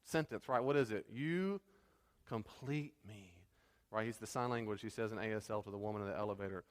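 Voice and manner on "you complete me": slow, drawn-out delivery with a pause before "complete me"; climactic movie line. Retold or imitated. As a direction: quote the big romantic line, stretching each word. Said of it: "the big climactic sentence, right?".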